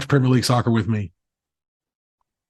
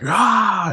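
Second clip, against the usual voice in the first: hulk roar; 11 semitones higher than usual. Retold or imitated. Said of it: "And then he's like".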